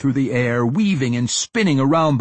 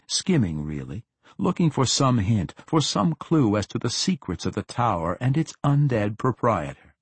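ordinary delivery, nothing marked

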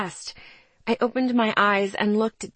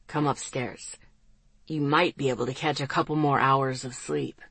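low, softened male voice; quiet, deep man's voice; rich baritone; 6 semitones lower, 3 dB quieter than usual. A narrator's reading. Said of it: "in that rich baritone that I loved".

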